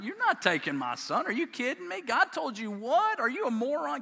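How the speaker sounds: mock-indignant, high-pitched mom; outraged mother. Imagined mother confronting dad over their son, mock outrage. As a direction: fire off incredulous questions, climbing in pitch.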